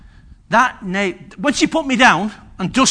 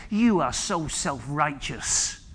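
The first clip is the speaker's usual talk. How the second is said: low, slow, quiet reproach; retold rebuke; 6 semitones lower, 9 dB quieter, much slower than usual. Pastor's wife rebuking the young husband, low and slow. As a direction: deliver a measured, hushed telling-off.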